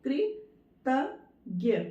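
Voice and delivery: कृतज्ञ very slowly as कृ, त, ज्ञ; split into syllables. Shown as a demonstration. Teacher counting letters for pupils, कृतज्ञ in three parts. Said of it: "कृतज्ञ में कितनी है".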